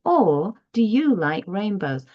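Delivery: "Or do you like rainbows" echoed high and lively; echoed question tune. Shown as a demonstration. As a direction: copy the bright question back. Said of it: "And then they would say".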